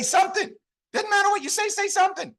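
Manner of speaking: very high-pitched squeaky insistence; exasperated answer. Retold or imitated. Coach answering people unsure what to say, high exasperation. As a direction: push the voice way up, as if stating the obvious.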